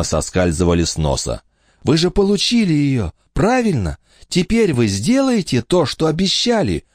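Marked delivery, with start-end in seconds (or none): 1.8-6.8 put-on higher, pleading boy voice. Michael begging the countess to keep her promise, pleading. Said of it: "умоляюще спросил он".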